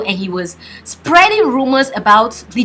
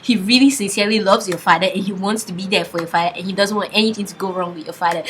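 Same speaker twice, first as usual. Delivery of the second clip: ordinary talk, nothing marked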